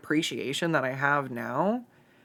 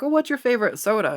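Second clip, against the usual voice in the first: mock asker; 7 semitones higher, 4 dB louder, faster than usual. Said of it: "people are like".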